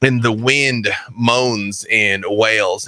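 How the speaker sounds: ordinary delivery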